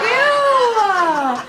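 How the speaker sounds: loud, high shriek of disgust. Real, not acted. Comic recoiling from a guy moaning her name, loud high shriek. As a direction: shriek it out, grossed out. Disgusted by man saying Stuart.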